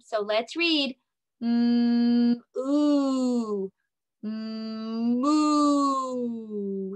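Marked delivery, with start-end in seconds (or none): 1.4-7.0 "moo" like a mooing cow. Pretend. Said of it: "Sounds like cows in here".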